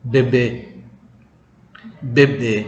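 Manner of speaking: Bebe with closed e sounds. Shown as a demonstration. Teacher models Portuguese Bebe, closed e sounds. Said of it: "Cara bacanya seperti ini".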